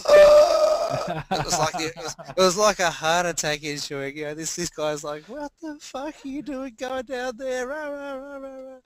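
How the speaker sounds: high, shocked falsetto of a horrified clerk; alarmed hotel clerk. Retold or imitated. Hotel clerk scolding the Aussie guest, high shocked falsetto. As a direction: play the appalled clerk, voice shooting way up. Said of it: "he goes".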